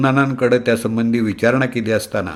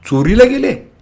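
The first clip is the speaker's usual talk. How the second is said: shocked disbelief; 13 semitones higher, 4 dB louder than usual. Acted. Passengers shocked by theft.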